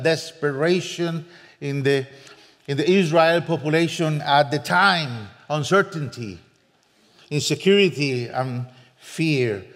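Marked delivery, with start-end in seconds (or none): none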